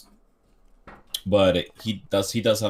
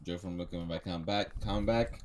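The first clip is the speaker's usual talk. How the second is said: fading echo call; 10 dB quieter than usual. Pretend. Calling a missing listener back.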